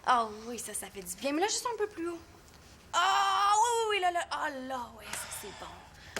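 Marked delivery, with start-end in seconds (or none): 2.9-6.2 exaggerated blissful moans; melting into the massage; pleasured sighs. Older sister enjoying a massage.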